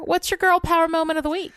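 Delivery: ordinary delivery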